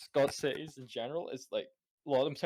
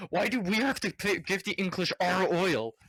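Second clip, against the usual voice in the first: higher-pitched mock complaint; a touch of mock outrage, pitched up; complaining Scots; 6 semitones higher, 4 dB louder than usual. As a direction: lightly imitate indignant grumblers.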